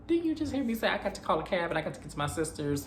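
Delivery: high, fast mock exasperation; exasperated. Angie voiced as rejecting the guard's invitation, mock exasperated. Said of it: "she didn't say it like that".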